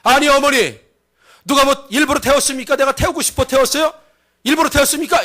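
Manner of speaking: high, indignant daughter-in-law; acted defiant retort, pitched high; angry talking back. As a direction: protest hotly at your mother-in-law. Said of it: "이 며느리가 화가 나는 거예요".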